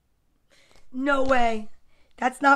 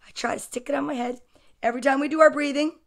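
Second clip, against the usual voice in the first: ordinary delivery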